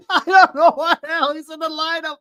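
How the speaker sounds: laughing. Real, not acted. Mocking echo of the announcer.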